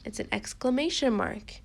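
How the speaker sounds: normal talking voice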